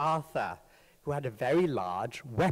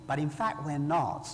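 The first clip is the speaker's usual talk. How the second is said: normal talking voice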